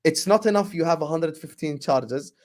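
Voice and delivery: ordinary talk, nothing marked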